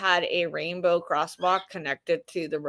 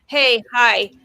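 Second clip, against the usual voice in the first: louder, biting sarcastic comeback; sarcastic angry retort; 3 semitones higher, 7 dB louder than usual. Real, not acted. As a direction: snap back with sarcasm. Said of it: "angry retort".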